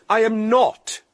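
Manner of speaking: indignant stern retort. Acted. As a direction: snap back, offended. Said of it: "she said sternly".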